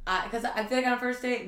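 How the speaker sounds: usual speaking voice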